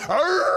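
high, loud wild roar; imitated madman roar. Preacher howling like the madman in the cave. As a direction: let out a wild chained-man howl. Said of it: "at nighttime you hear him going".

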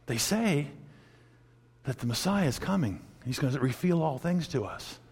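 soft and low; hushed, tentative quote. Retold or imitated. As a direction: offer it quietly, feeling the way. Voicing the woman's tentative confession.

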